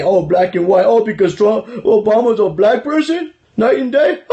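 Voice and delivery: a put-on, gasping offended voice, rising into a laugh; mock liberal outrage. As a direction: parody a pearl-clutching critic. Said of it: "they're gonna call me a racist".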